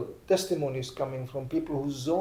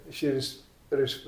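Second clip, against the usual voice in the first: ordinary talk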